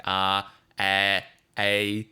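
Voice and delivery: "ah, eh, a" placed far back in the mouth; Aussie vowels "ah, eh, a" made at the back. Shown as a demonstration. Streamer showing where Aussie vowels sit, back-of-mouth placement. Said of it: "it's all in the back of the mouth".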